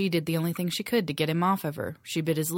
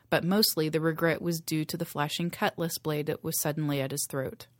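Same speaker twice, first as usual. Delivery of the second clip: ordinary delivery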